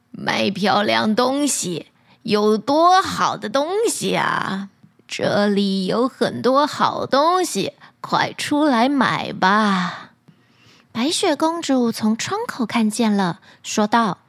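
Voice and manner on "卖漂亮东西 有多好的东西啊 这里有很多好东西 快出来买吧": put-on low, slow old peddler woman; a disguised hag calling out her wares, slowly; old crone voice. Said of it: "喊道".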